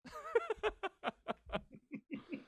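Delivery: high, squeaky burst of laughter. Real, not acted. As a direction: let a giggle rise up high. Laughing at own story.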